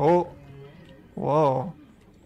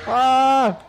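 high, loud panicked yelling; panicked screams; 11 semitones higher, 8 dB louder, much slower than usual. Real, not acted. Gamer shrieking at exploding fish underwater.

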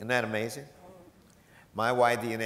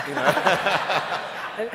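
high laughing you know, louder; 9 semitones higher, 8 dB louder than usual. Real, not acted. Joking to the audience about Post-it pads, laughing. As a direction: toss it off mid-laugh.

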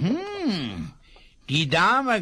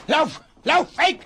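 higher, louder urgent shout; shouting at horse; 8 semitones higher, 6 dB louder than usual. Acted. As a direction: drive the horse off with a yell. Driving his horse away.

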